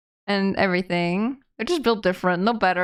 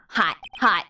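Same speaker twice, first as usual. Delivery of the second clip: ordinary talk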